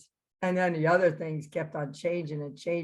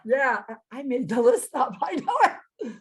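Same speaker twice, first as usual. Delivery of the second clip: put-on laughing kid; 6 semitones higher than usual. Kid caught inventing burpees.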